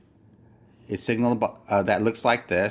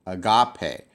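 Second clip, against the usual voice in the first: "agape" repeated as ah-GAH-pay, stress in the middle; stress on middle syllable; 3 semitones lower than usual. Shown as a demonstration. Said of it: "It's actually here".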